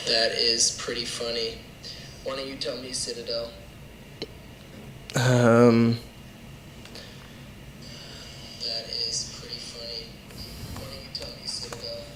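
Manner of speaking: faint delayed echo of the voice. Real, not acted. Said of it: "sorry about the echo".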